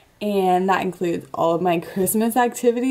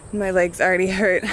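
ordinary talk, nothing marked

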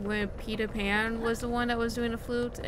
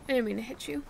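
normal talking voice

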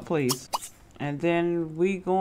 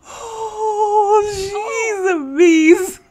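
high, slow laughter-tears; cry-laughing; 13 semitones higher, 4 dB louder, much slower than usual. Real, not acted. Mom amazed at her daughter, laughing to tears. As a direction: wheeze it through tears. Laughing so hard she cries.

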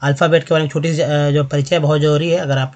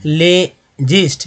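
"लेजिस्ट" slowly as ले, pause, gist; syllable by syllable; much slower than usual. Shown as a demonstration. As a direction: split "लेजिस्ट" after le for learners. Teacher breaks a word into syllables.

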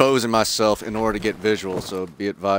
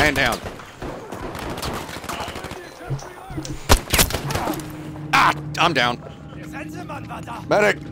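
man down shout; 13 semitones higher than usual. Real, not acted. Hit in firefight, calling for help.

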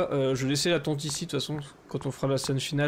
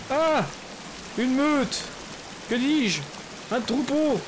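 mock grandiloquent; 8 semitones higher, slower than usual. Theatrical self-correction mid-fight.

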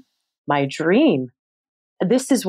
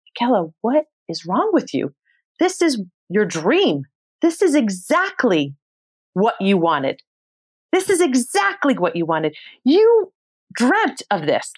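self pep talk; 8 semitones higher than usual. Retold or imitated. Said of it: "I was literally giving myself a pep talk".

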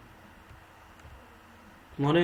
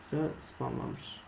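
whisper; 10 dB quieter, slower than usual. Real, not acted. Muttering while gaming.

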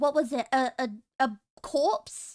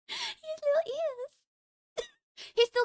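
cooing; 12 semitones higher, 4 dB quieter, much slower than usual. Real, not acted. Cooing over the frog.

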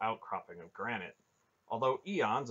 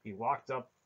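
ordinary delivery

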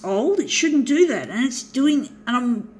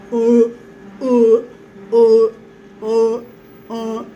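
loud grunting copy of the machine's squeak; imitated squeak; 9 dB louder, slower than usual. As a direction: mimic the copier squeaking, louder. Mimics the copier's squeak.

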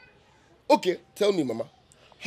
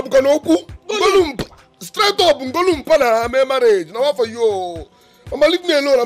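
higher, louder exasperated yelling; heated shouting; 6 semitones higher, 6 dB louder than usual. Acted. Son yelling at his mother to leave him alone. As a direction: push her away with the voice.

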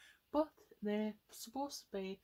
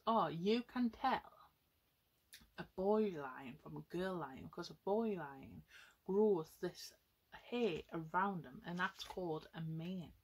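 ordinary talk

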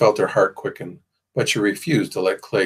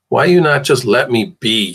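louder angry demand; angrily; 3 semitones higher, 7 dB louder than usual. A narrator's reading. Tied-up girl demanding to be left alone, angry. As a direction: push back hard at him. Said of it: "asked angrily".

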